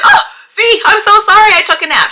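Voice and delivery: high laughing apology. Retold or imitated. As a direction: laugh through a sheepish sorry. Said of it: "I woke up and I was like".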